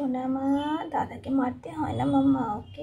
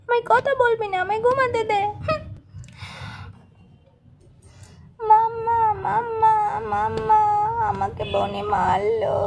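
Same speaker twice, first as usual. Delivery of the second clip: a higher, louder sobbing call for mummy; crying child; 4 semitones higher, 4 dB louder than usual. Acted. Little boy crying to his mother that his sister hit him, sobbing. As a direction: wail for mama like a hurt kid.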